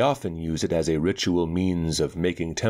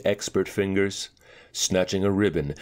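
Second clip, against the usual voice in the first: usual speaking voice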